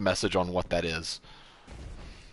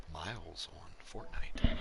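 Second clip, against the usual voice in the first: usual speaking voice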